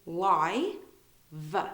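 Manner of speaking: LIE, pause, then a separate V sound; sounded out live. Shown as a demonstration. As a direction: break the word into LIE and V. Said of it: "So you say it like you say".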